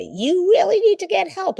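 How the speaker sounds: high, loud scolding nag; nagging wife. Retold or imitated. Wife nagging her husband to get help, high loud scolding. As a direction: jab it at him like a nagging wife. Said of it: "add to it and say".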